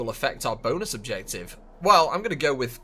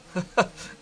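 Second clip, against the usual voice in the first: short laugh; 7 semitones higher than usual. Real, not acted. Laugh after slide.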